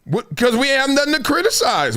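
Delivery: ordinary talk, nothing marked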